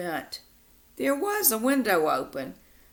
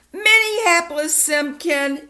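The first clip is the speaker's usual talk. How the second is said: full name called out higher and louder; the angry-mom full-name yell; 8 semitones higher, 8 dB louder than usual. Acted. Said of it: "yelled Mom".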